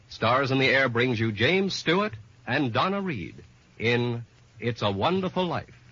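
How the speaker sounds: ordinary talk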